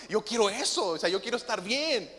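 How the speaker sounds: normal talking voice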